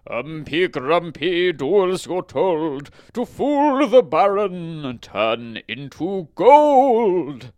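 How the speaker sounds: slow, loud, high incantation; wizard casting a spell. A narrator's reading. Wizard turns leaves to gold, slow loud spell. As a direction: chant it slowly, building to a huge last word. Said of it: "remembered one of his magic spells".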